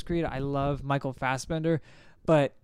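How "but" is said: ordinary talk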